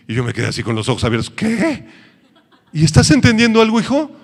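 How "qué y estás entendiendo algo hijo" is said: loud, high laughing disbelief; astonished pastor. Retold or imitated. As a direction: react in shocked disbelief. Pastor amazed at young man.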